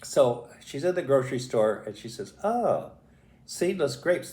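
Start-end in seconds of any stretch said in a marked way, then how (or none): 2.4-4.3 higher-pitched retold "aha" moment; pleasant discovery. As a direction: act out spotting just the thing. She spots grapes at the store.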